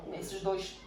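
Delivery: ordinary talk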